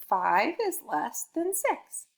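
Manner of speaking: slow, word by word answer; slow model answer. Shown as a demonstration. Teacher modelling the answer for a child, slow and clear. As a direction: model the answer sentence slowly. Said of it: "five is the smaller number".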